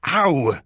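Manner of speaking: high, loud "ow"; pained yelp. Acted. Man bitten by his cat while phoning, pained yelp. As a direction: yelp from a sudden bite.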